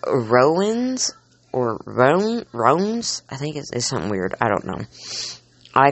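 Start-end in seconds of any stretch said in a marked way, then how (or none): 0.1-1.1 "Rowans" in two syllables with a long O, pitch climbing; pronounced "Rowans". Shown as a demonstration. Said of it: "I've heard them pronounced". 1.5-3.2 "Roan, Roans" as one syllable with a long O, rising; pronounced "Roans". Shown as a demonstration. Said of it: "I've heard them pronounced".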